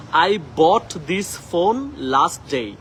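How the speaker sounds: "I bought this phone last day" slowly, word by word; slow model sentence. Shown as a demonstration. Teacher reads an example sentence slowly. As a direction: drag it out for students. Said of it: "bought না বলে এটাকে আমরা বলবো bought".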